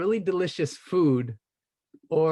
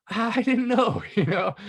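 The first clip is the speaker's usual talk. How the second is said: laughing; 7 semitones higher, 4 dB louder, slower than usual. Real, not acted. Laughing after the realization.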